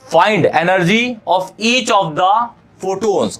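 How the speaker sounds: reading out the question word by word, slowly; slow dictation pace for the class. Shown as a demonstration. Teacher reading the question to students, slow dictation.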